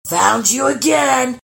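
loud, high, slow gleeful shout; gleeful troll shout. Acted. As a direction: crow it out in triumph. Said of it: "he said gleefully".

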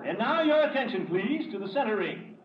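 high booming ringmaster announcing; ringmaster announcement. Acted. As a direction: announce it grandly to the big top. Ringmaster presents the final act.

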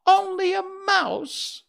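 very high, loud, slow outraged mouse cry; indignant squeak. Acted. Mouse queen offended by the Woodman, loud high indignant squeak. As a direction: bristle with tiny royal offence. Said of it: "cried the little animal indignantly".

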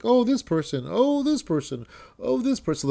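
high singled-out introductions, imitated; audience-vote introductions. Mimicking hosts presenting comics for a vote, high mock introductions.

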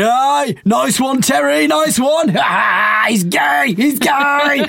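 high, shouted laddish jeers; jeering stag crowd. Retold or imitated. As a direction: shout it like a rowdy stag party ribbing a mate. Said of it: "everyone's like, going".

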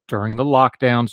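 normal talking voice

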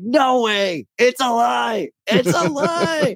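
high, shouting mock disbelief; hammed-up denial. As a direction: wail it like a betrayed man. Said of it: "He's like".

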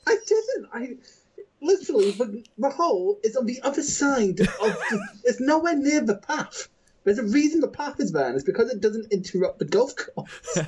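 high-pitched, indignant self-defence; laughing protest. Real, not acted. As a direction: protest your innocence, half laughing. Protesting teasing, half laughing.

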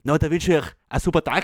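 playful Tyrolean farewell; Tyrolean accent. Acted. Co-host bidding listeners goodbye, Tyrolean accent. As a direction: wish them well in Tyrolean. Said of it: "noch, noch unser Tiroler".

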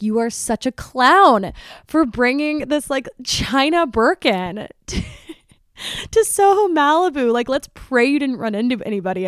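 hammed-up incredulous ribbing; mocking scolding. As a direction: hoot it at a friend who messed up. Retold friend mocking her over the bag.

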